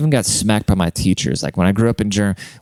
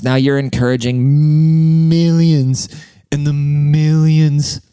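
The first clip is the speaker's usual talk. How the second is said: slow, louder, drawn-out movie-star boom; The Rock impression; 5 semitones higher, 4 dB louder, much slower than usual. Mocking Donald Trump Junior's post, booming like The Rock. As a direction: stretch every word like a wrestler cutting a promo. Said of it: "That's what The Rock reference".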